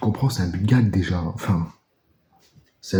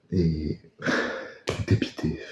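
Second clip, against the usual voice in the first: dejected whisper; 4 semitones lower, 6 dB quieter, slower than usual. Real, not acted. Dejected sigh, unvoiced.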